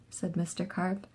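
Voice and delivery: ordinary delivery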